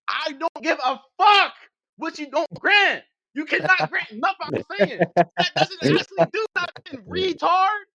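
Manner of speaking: slow, high, loud yelling; exasperated angry shout. Real, not acted. Debater repeats point one last time, exasperated angry shout. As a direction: hammer each word out at full pitch.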